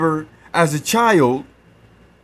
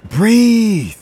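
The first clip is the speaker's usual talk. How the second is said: normal talking voice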